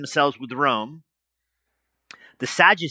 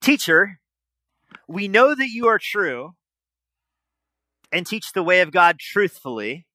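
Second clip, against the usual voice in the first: higher, slow, syrupy flatterer; butter him up with fake respect, slowly; oily courtier pretending to honour the teacher; mock flattery; 5 semitones higher than usual. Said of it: "Notice how the Herodian starts".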